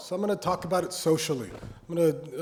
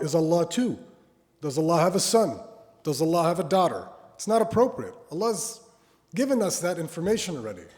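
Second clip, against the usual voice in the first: louder, higher emphatic preaching; 3 semitones higher, 4 dB louder than usual. Acted. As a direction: drive the point home. Preaching at rising force.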